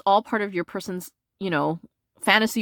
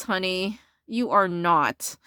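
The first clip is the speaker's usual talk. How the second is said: normal talking voice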